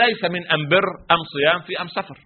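أم بر، أم صيام، أم سفر with am for al; Himyari dialect. Retold or imitated. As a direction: swap every al for am in أم بر and أم سفر. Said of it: "ولكن لا لام عندنا تنطق". (بلهجة حمير: أم بدل أل في أم بر وأم صيام وأم سفر)

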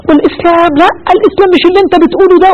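high, fast, heated arguing in a debate. Retold or imitated. Preacher quoting sheikh arguing in a debate, heated and fast. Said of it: "فعمال في مناظرة".

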